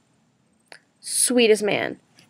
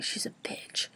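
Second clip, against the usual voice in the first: whispered aside; 18 dB quieter, slower than usual. Real, not acted. Vlogger whispering her verdict on the math teacher. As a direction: repeat the insult in a secret whisper.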